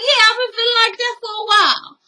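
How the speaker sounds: high, quick mocking husband. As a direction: parody him in a high voice. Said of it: "He was like".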